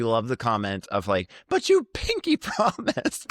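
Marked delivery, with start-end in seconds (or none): 1.5-3.3 high, slow mocking pinky promise; high slow whiny mockery; mocking whine. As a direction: whine it like a hurt child. Quoting pinky promise line.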